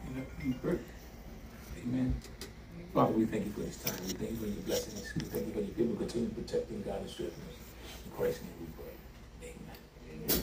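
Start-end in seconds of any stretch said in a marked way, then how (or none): 2.9-10.4 soft, low prayer voice; closing prayer. Acted. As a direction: pray quietly over the people.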